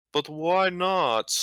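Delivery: slow, high puzzled question; drawn-out crewman objecting; mock puzzled crewman. Other crewman protests.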